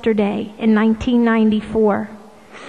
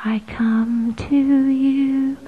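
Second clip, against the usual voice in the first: a slow hushed whisper; 6 semitones higher, much slower than usual. Acted. Jesus speaking tenderly to the faithful, slow whisper. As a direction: let each word fall as a breath. Voicing Jesus over music.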